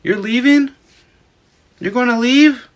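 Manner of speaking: high, hurt question; high hurt girlfriend voice; girlfriend's plea. Retold or imitated. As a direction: play her wounded, high-voiced. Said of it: "she was like".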